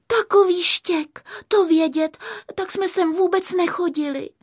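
high, upset little character voice; zdrcená Káťa. A narrator's reading. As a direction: lament in a high voice. (vysoký zklamaný hlásek)

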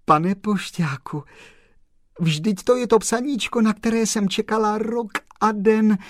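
high, loud overjoyed cry of thanks; a girl's delighted, tearful rush of thanks; joyful girl. A narrator's reading. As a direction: gush with happy gratitude.

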